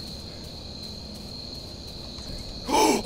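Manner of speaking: long, sharp frightened gasp; startled gasp. Real, not acted. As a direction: suck in air in fright. Sudden fright.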